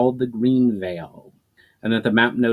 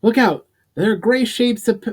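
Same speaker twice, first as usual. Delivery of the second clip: much higher, louder alarmed shout; calling out a warning; 12 semitones higher, 5 dB louder than usual. A narrator's reading. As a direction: yell a warning from the tree. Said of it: "when Kylan called out".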